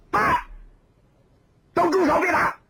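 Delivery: put-on high young woman's voice, stopping a fight; young woman calling out. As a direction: cry out to make them stop, high and urgent.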